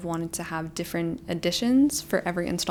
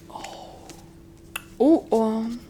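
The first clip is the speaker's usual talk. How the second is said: awed; 3 semitones higher, much slower than usual. Real, not acted. Awed reaction after gasp.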